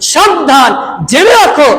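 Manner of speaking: imitating a reproving caution; retold stern warning. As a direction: warn them firmly, as if quoting a rebuke. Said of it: "রাসূল যে ইসলাম তাদের ধমক দিলেন".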